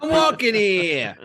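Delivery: high, drawn-out mock New York accent; exaggerated slow New York call. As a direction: stretch it out like a hammed-up New Yorker. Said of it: "It's a New York accent".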